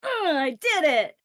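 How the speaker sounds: high, loud, drawn-out delight; loud, high-pitched cry of success; excited cheer. Real, not acted. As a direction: stretch it out in triumphant excitement. Cheering own success in game.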